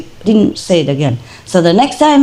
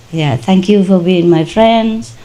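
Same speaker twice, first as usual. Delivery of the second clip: ordinary delivery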